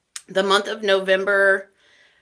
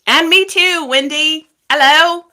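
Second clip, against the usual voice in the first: high, loud mock British accent; exaggerated, misplaced British accent, pitched high; wrong put-on accent; 8 semitones higher, 10 dB louder than usual. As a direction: call out a greeting in a botched British accent. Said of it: "I did the wrong accent".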